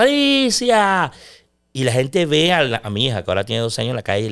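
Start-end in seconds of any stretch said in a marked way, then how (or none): 0.0-1.1 long, high, drawn-out stadium yell; sportscaster shout. Shown as a demonstration. Commentator shouting his daughter's name mid-match, drawn-out yell. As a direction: stretch the name like a goal call. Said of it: "Y por eso gritaba el veintiocho".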